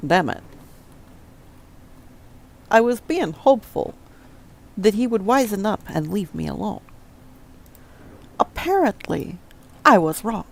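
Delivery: put-on a clumsy Christopher Walken accent, odd pauses between phrases; bad Christopher Walken impression. To his viewers, in a bad Christopher Walken accent. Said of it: "a very horrible Christopher Walken accent".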